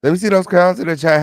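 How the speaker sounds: rally the chat, punch it up; hype call. Acted. Host hyping viewers for the roll call. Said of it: "Crown Family Roll Call".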